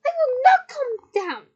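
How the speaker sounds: high, loud, slowed defiance; enunciated angry scream. A narrator's reading. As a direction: scream each word clearly and slowly. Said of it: "she screamed".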